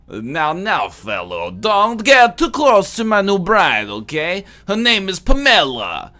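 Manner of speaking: put-on high, loud, heavily accented comic foreigner; Borat. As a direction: proclaim it in a hammed-up foreign accent. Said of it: "I'm doing an impression of Borat".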